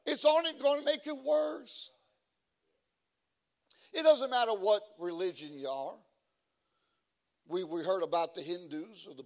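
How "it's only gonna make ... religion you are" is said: high, loud, singsong preacher's cry; hollering preacher, nearly singing; preaching at full force. Acted. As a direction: chant it out like a revival climax. Preaching climax again.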